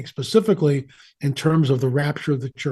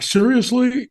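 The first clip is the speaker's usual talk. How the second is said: incredulous; 9 semitones higher, slower than usual. Retold or imitated. Wife's incredulous reply retold.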